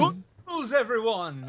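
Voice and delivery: put-on high, creaky old professor voice; doddering old scientist announcing; Professor Farnsworth impression. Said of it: "That was excellent".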